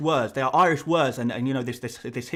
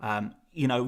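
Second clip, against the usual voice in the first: ordinary delivery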